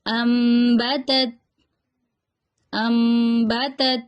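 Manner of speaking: امبتت drawn out very slowly, noon sounded as meem; iqlab demo. Shown as a demonstration. Teacher models for students to repeat, slow امبتت with meem. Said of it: "نون کی آواز کو میم سے بدل دیں گے".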